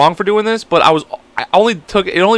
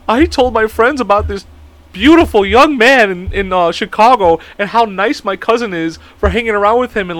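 retold tearful reproach; 6 semitones higher than usual. Imitating the cousin learning it was all an act, mock tearful reproach. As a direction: mimic her hurt lament, pitched up. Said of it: "And she was crying".

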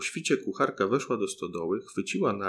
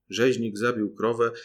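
usual speaking voice

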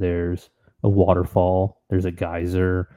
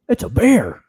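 high-pitched retold cry of discovery; excited realization; 12 semitones higher, 3 dB louder, slower than usual. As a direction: blurt it like you just spotted it. Retells excitedly spotting a bear.

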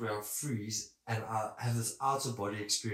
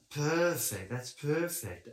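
"perfect" raised and stretched on the first syllable; higher, drawn-out "perfect" with a falling end; catchphrase perfect; 5 semitones higher, much slower than usual. Retold or imitated. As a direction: lift and hold "per" in each "perfect". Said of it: "Saying perfect".

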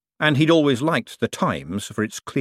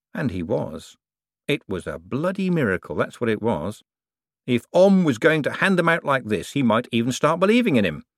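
ordinary delivery, nothing marked

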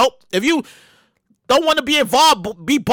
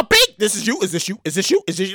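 lower, questioning bank voice; bank alert voice; 7 semitones lower than usual. Retold or imitated. Bank checking a suspicious charge, lower questioning voice. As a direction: play the bank's fraud check.

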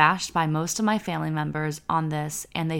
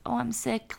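whiny sick voice; 6 semitones higher, 5 dB quieter than usual. Retold or imitated. Mocking people who preface episodes.

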